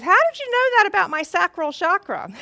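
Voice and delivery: high and loud, wide-eyed astonishment; amazed question. Retold or imitated. Him asking her out of the blue, high and amazed. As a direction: ask it as if truly stunned. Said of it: "he goes".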